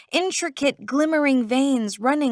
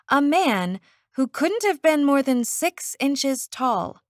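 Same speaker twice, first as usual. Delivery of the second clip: usual speaking voice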